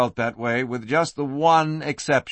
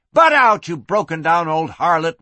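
snappy retort; 3 semitones higher, 5 dB louder than usual. Acted. Cornered woman snaps back at the madam, snappy retort. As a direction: snap back loudly. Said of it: "was Lucy's snappy response".

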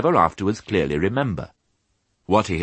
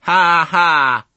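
high, loud bleated mock laugh; lamb's jeering ha-ha; bleating; 12 semitones higher, 8 dB louder than usual. A narrator's reading. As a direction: bleat a laugh like a big lamb. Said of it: "bleated a big lamb".

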